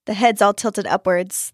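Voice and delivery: ordinary delivery, nothing marked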